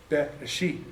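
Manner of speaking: Be, re, she read softly as syllables. Shown as a demonstration. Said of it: "first three letters with their vowels".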